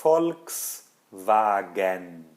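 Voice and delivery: Volkswagen drawn out slowly, German style; German pronunciation. Shown as a demonstration. Teacher modelling the brand name in German. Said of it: "a gente pronuncia assim".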